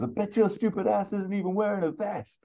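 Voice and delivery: high-pitched needling retort; acted high, scornful jab; Axel Foley. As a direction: pitch it way up in an exasperated character voice. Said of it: "and Axel just goes".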